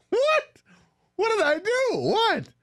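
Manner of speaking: very high, bewildered yelps; startled protest. Retold or imitated. Teen driver reacting to dad's yell, high-pitched startled protest. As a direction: re-enact a startled, defensive squeal.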